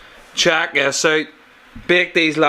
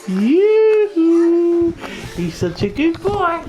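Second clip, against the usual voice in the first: high cooing; 12 semitones higher, 3 dB louder, much slower than usual. Real, not acted. Dad praising his baby son in a high coo.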